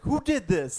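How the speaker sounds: high-pitched mock demand; mock outraged Tony. Viewer quoting a TV father confronting his son, mock outraged. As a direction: act out a father's outraged question. Voicing Tony's angry reaction in scene.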